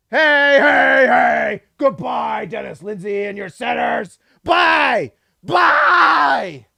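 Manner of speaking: triumphant jeer; gleeful shout. Real, not acted. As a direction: yell it at the leaving rival. Taunting departing executive.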